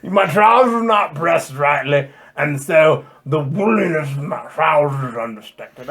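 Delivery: a hint of a fussy Englishman fretting over his trousers; old British guy. Preacher mocking the translation as an old British guy, mild British accent. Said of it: "sounds like an old British guy that's like".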